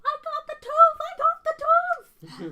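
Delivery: high, very loud triumphant shout; excited spectator shrieking over a crowd; crowd yell. Acted. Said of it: "you hear from the crowd yell".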